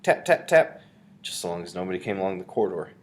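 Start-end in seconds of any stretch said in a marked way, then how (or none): 0.0-0.8 high, loud, percussive imitation of knocks; spoken knocking effect; loud tapping. A narrator's reading. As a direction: sound out each knock sharply. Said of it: "The bottle made quite a loud tap".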